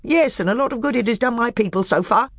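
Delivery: high, bitter snap of a dwarf; snapping back at the badger; snapped retort. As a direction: bite the words off in resentment. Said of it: "snapped Knickerbrick".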